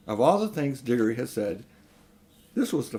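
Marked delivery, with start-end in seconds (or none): none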